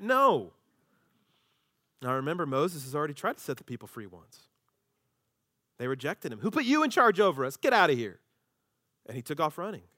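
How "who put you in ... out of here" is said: loud, scornful rejection; mild mock anger. Israelites driving Moses off, loud and scornful. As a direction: brush him off hard.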